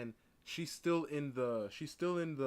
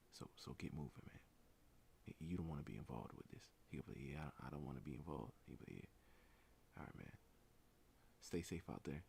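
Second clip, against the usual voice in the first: hushed talk; 10 semitones lower, 14 dB quieter than usual. Pretend. Imagined hushed chat with bystander.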